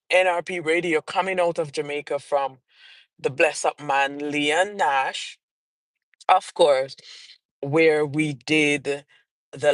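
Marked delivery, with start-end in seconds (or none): none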